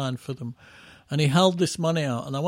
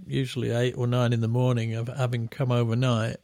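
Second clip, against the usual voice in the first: usual speaking voice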